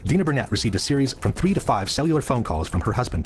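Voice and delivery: ordinary talk, nothing marked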